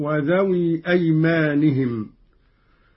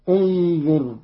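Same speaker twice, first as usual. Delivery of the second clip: ordinary talk, nothing marked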